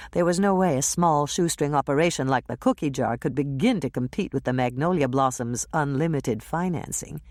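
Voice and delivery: plain reading voice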